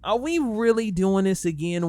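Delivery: loud, high, fed-up challenge; are you serious, called out loud and high; exasperated disbelief. Real, not acted. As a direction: throw the question at him in disbelief. Exasperated call-out to Logic.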